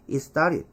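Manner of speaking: "it started" as "i-starid"; no T in "it", tapped T in "started"; 원어민식 발음. Shown as a demonstration. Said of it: "원어민은". ('이스따릿'으로 이어서)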